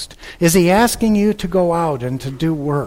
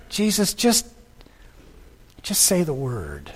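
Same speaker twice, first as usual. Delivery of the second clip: quiet plea; 7 dB quieter than usual. Acted. Preacher voices centurion's humble request.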